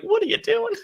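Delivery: a high, strained cry of disbelief; high squeal of disbelief at the screen; baffled squeal. Retold or imitated. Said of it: "I'm like".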